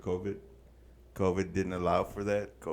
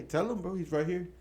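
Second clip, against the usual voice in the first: taunting guard; 9 semitones higher, 3 dB louder than usual. Retold or imitated.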